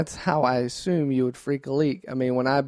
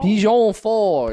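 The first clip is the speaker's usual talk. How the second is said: "Pigeon Forge" slow, louder, drawn out Southern; local Tennessee twang; 6 semitones higher, 5 dB louder, slower than usual. Shown as a demonstration. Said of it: "that's how they say it".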